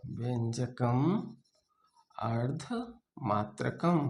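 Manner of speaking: slow and soft, chunk by chunk; slow dictation. Shown as a demonstration. Teacher dictating the verse slowly for notes. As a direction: drag it out so they can note it. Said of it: "repeats the verse for dictation".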